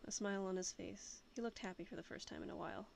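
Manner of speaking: ordinary delivery, nothing marked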